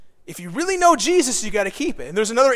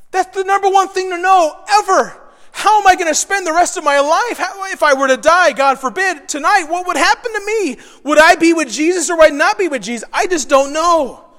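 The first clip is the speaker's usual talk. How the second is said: high, loud, urgent self-questioning; acted worry, pressing and raised; anxious questioning; 6 semitones higher, 6 dB louder than usual. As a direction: fret aloud, pushing each question higher. Voices an anxious believer's questions.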